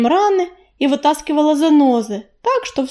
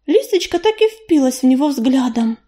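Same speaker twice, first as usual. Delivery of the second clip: usual speaking voice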